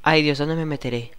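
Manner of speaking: ordinary talk, nothing marked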